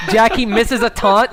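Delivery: usual speaking voice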